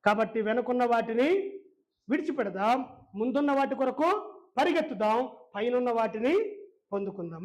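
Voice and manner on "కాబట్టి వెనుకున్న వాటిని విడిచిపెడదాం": usual speaking voice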